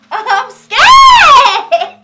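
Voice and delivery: high, very loud squeal with laughter; scared squeal. Real, not acted. Nervous vlogger waxing herself, scared squeal.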